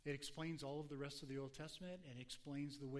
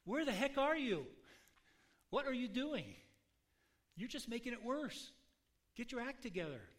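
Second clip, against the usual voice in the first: loud, higher, exasperated demand; bold complaint to God; 7 semitones higher, 6 dB louder than usual. Acted. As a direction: confront God like a frustrated friend. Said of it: "that's a pretty bold conversation Moses had with God".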